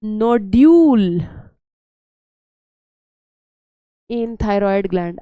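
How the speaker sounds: drawn-out, measured dictation of a term; slow dictation. Real, not acted. Teacher dictating notes to students, slow dictation. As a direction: stretch each word out for note-takers.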